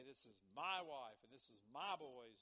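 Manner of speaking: "my" punched loud each time; stress on my. Acted. Preacher showing how we claim family, loud stress on "my". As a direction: hit the word "my" hard.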